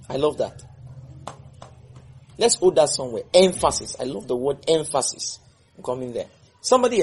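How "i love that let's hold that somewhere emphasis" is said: ordinary delivery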